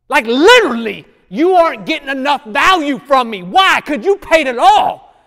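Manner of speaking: loud, high sermon cry building up; preaching at full force. Acted. Preacher crying out to the congregation, preaching at full force. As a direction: preach it like you're crying out to heaven.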